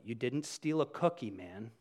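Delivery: low reproof, dropping away at the end; retold rebuke. Speaker correcting the person who downplayed it, low rebuke. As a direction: bring them down to earth quietly. Retold stern reply to the offender.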